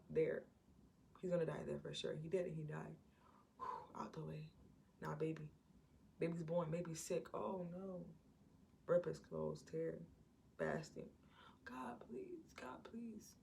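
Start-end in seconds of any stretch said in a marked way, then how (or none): 3.6-4.5 relieved whisper. Retold or imitated. David relieved after the killing. 11.6-13.3 hushed prayer. Retold or imitated. David prays for his sick baby.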